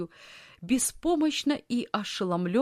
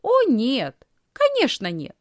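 high, loud, quick denial; undaunted; 5 semitones higher, 7 dB louder than usual. A narrator's reading. Girl answering her angry aunt, undaunted. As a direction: protest emphatically, not intimidated. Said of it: "Лисхин не дала себя запугать".

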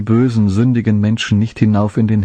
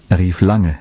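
usual speaking voice

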